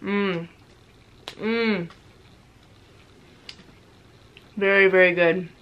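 talking with mouth full; muffled, closed-mouth hums; chewing chocolate. Real, not acted. Seen 2: Bite at -9; closed-mouth chewing and hand over mouth until ~9s.

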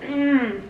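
savoring mmm. Real, not acted. Tasting pancake.

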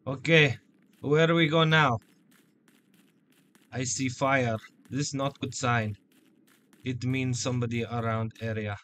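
put-on Russian accent; deliberate Russian-accented English. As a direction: speak as a mock Russian. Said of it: "Do you like my Russian accent?".

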